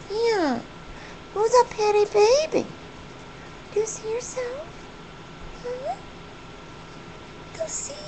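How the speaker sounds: high-pitched cooing questions; doting pet talk. Real, not acted. Owner asking her pretty bird if she sees herself, high baby talk.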